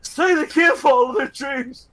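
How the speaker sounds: high, loud, crying plea; tearful triumphant hammed-up speech; mock tearful speech. Shark man to the judge, hammed-up tearful speech.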